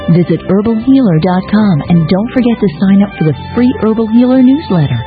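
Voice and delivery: ordinary talk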